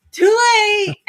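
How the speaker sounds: loud high laughing cry of too late; shouted retort. Real, not acted. Wife shouting back at her drunk husband, loud and laughing. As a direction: blurt it out, half laughing.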